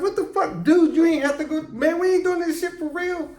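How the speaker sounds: high, loud indignant protest at a sparring partner; mock outrage. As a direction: yell it up high like he went way too hard. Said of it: "I was like".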